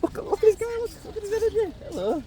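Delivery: high-pitched cooing at a dog; pet talk. Real, not acted.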